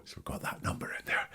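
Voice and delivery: quick breathy whisper to the listener; a whispered note to the audience; whispered aside. Real, not acted. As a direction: whisper it like a secret.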